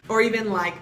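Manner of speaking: usual speaking voice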